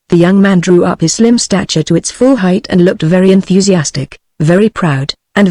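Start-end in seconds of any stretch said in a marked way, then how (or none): none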